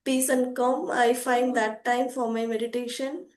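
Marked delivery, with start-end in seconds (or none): none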